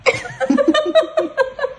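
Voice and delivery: squealing burst of laughter; high squealing laugh. Real, not acted. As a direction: shriek with laughter, then fall. Laughs at the hidden hand. (ऊँची चीखती हँसी)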